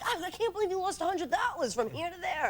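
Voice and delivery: high, exasperated retold complaint. Girlfriend scolding boyfriend over lost money, exasperated. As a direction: rail at him in disbelief.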